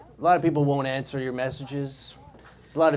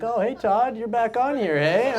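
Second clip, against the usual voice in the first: cheery creepy guy; 8 semitones higher than usual. Acted. Chatty guys greeting on Omegle.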